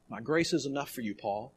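barely above a hush, comforting; tender and hushed. Acted. God comforting the struggling Paul, very softly. God's words repeated to Paul.

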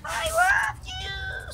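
very high, slow squeaky "I love you"; high cat voice. Shown as a demonstration.

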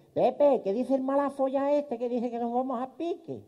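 high put-on old lady voice; a puzzled grandma asking her husband; old woman voice. Grandma asks Pepe about the captain, high and puzzled.

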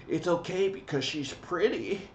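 high put-on voice mocking a naive guy; mock simp. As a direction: sneer it as a fool's excuse. Mocking the viewer's excuse.

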